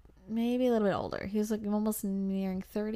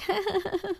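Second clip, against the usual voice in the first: a short nervous laugh, pitched up high; 11 semitones higher than usual. Retold or imitated. Nervous high laugh at the joking stranger.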